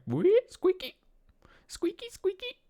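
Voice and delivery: tiny, very high falsetto squeak; silly high-pitched chair noises; playful squeaky voice. Pretend. Voicing a turning, squeaking chair.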